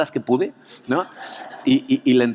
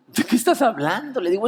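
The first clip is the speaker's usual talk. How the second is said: dismayed protest; 10 semitones higher than usual. Retold or imitated. Speaker's own retold protest.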